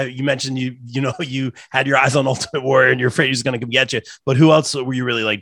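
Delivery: ordinary talk, nothing marked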